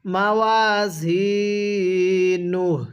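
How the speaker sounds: very slow, drawn-out tilawat; slow recitation. Shown as a demonstration. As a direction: chant it slowly for students to repeat. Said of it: "আমার সাথে পড়বেন". (ধীর তিলাওয়াত)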